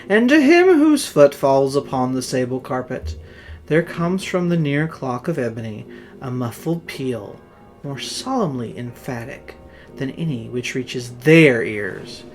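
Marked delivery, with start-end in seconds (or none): none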